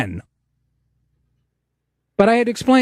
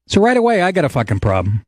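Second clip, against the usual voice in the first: usual speaking voice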